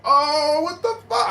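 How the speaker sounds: very high, stunned exclamation; acted shock. Stabbed man looking at his wounds, high shocked outcry. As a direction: relive the disbelief, pitch shooting up. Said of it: "I was really just in shock".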